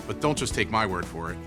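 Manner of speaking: ordinary talk, nothing marked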